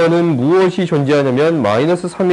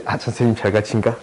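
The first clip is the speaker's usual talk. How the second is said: imitating a student's thought; 7 semitones lower, 6 dB quieter, faster than usual. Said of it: "이런 거 딱 아냐?".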